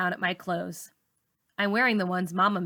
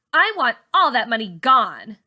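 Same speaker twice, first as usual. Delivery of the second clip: bossy order; 3 semitones higher, 8 dB louder than usual. A narrator's reading. Char orders burning money.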